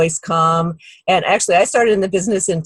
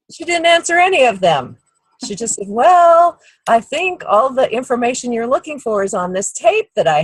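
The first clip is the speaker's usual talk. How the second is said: excited retelling; 6 semitones higher than usual. Retold or imitated. Retelling the nurse's clever reply.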